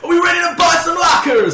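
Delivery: high, loud mock-hype shout; hammed-up excited yell; overexcited host. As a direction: whip up the crowd like an overexcited American show host. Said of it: "Nobody is that excited ever".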